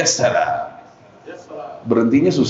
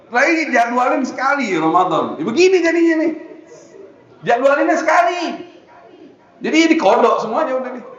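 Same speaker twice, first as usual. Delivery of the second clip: excited teasing complaint; 9 semitones higher than usual. Real, not acted. High loud joking complaint.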